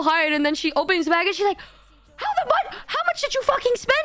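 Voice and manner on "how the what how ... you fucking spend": high squawk of disbelief; shocked friend. Retold or imitated. Friend opens the bag of purchases, shocked squawk.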